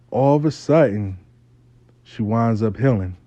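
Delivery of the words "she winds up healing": normal talking voice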